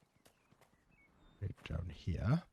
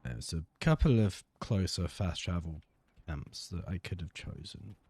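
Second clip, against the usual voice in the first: usual speaking voice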